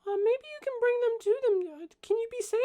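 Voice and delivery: put-on very high little kid voice; small child. Young halfling answering the adults' questions, in a high child voice. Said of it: "this child cannot".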